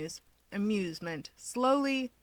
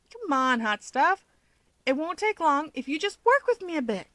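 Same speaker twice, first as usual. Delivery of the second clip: higher, coaxing hero voice; bargaining Keigo; 8 semitones higher, 3 dB louder than usual. A narrator's reading. Keigo coaxing Toya for a fun fact, higher and wheedling. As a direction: wheedle him into it, playfully. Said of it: "he bargains".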